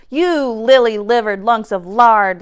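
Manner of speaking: loud, scornful pirate rebuke; lashing out. A narrator's reading. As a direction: berate the cowardly crew. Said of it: "lashed out Dirty Joe".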